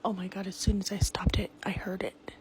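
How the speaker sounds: hushed, scared whisper; frightened whisper. Real, not acted. Mother filming a haunted house, whispering in fear. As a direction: whisper so the noise isn't drowned out.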